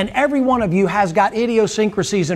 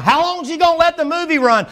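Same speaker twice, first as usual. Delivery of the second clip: preaching climax; 6 semitones higher than usual. Real, not acted. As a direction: lift it up and stretch it out like a revival.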